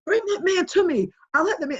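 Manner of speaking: high, loud commanding demand; angry king. Retold or imitated. Preacher voicing King David angrily ordering the man brought. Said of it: "David gets angry".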